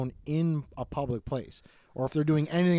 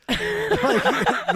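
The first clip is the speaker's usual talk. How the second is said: high burst of laughter; laughing; 5 dB louder than usual. Real, not acted. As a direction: crack up on the word.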